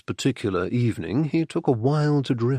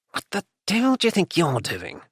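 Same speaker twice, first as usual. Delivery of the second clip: quick, higher-pitched hiss; hissed reproach; 6 semitones higher, faster than usual. A narrator's reading. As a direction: hiss an urgent challenge at him. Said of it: "he hissed".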